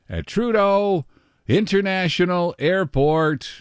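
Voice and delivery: high, drawn-out place name; hammed-up announcer flourish. Radio host giving the airport weather, grandly announced.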